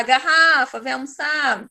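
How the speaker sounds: "Garrafa" called like a name, long RRA; calling out. Shown as a demonstration. Teacher calling a bottle to lunch, calling out. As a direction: call "Garrafa" to lunch, stretching the middle syllable. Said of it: "Então, quando eu falar".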